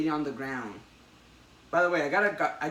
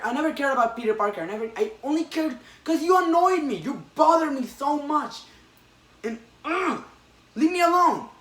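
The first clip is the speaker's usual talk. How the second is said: much higher, louder, fast raging villain; furious rant; 9 semitones higher, 6 dB louder than usual. Retold or imitated. Retelling the comic, the Goblin rants at Peter, furious. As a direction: spit it out in rising fury.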